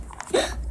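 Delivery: quick unvoiced gasp; breathless gasp. Real, not acted. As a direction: gasp at the jump. Jump succeeds.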